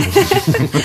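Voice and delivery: a short, high, surprised laugh; high amused laugh. Acted. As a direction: burst out laughing in delight. Laughs at the sudden proposal.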